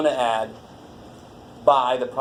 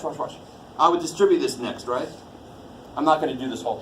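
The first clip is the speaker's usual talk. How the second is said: ordinary talk, nothing marked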